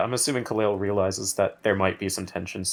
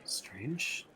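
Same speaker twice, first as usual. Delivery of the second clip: hushed whisper; 2 semitones higher, 13 dB quieter than usual. Acted. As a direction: breathe the word. Whispered in character.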